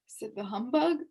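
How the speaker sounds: plain reading voice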